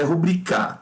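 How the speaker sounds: "rubricar" stressed on the last syllable, final r dropped; wrong stress rubriCÁ. Shown as a demonstration. Teacher mocking an oxytone reading, rubriCÁ stressed last. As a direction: land on CÁ at the end of "rubricar". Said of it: "não é rubricar".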